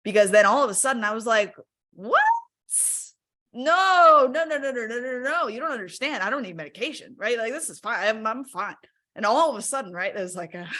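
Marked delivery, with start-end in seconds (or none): 1.9-8.8 high, loud alarmed no-no-no; shocked refusal. Retold or imitated. To the doctor, rejecting medication, high shocked protest. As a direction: recoil in shock, insisting you're fine.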